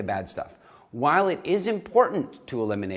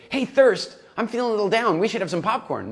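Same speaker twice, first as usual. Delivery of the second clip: high, louder coaxing voice of a moping character; higher pitched mopey pal, bright and pleading; whiny emotional hunger; 9 semitones higher, 4 dB louder than usual. Acted. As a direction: wheedle a friend into a snack. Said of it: "the emotional hunger guy goes along and he goes".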